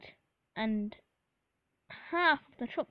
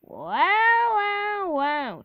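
loud, drawn-out teasing, falling from high; amused, hammed-up teasing; Edward chuckling; 8 semitones higher, 10 dB louder, much slower than usual. A narrator's reading. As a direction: start high and loud, stepping down knowingly. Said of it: "chuckled Edward".

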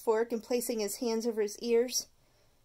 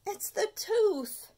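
slow, drawn-out excuse, higher; Stuart's refrain; 10 semitones higher, slower than usual. Acted. Stuart blaming his sweet tooth, slow and whiny. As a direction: blame it on the tooth, slowly.

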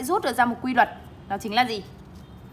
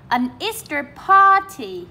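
party with PAR high and long; PAR-ty, first syllable stressed; 4 semitones higher, 5 dB louder, slower than usual. Shown as a demonstration. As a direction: slowly model Easter party, punching PAR. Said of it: "trọng âm một".

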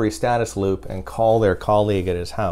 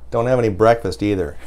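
laughing. Retold or imitated. Retelling prank call, laughing.

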